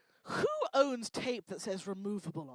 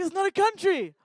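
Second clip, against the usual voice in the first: high, loud retort; mock exasperated yell; 7 semitones higher, 10 dB louder than usual. Host retelling her reply to a Californian, mock exasperated yell.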